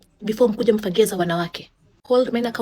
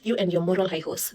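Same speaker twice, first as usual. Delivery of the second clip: ordinary talk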